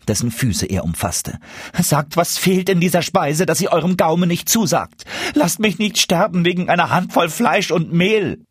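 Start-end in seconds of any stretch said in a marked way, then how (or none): 1.8-8.5 much higher, desperate pleading; cling to him and beg; begging dwarf. A narrator's reading. Dwarf begs the guest for the secret, desperately.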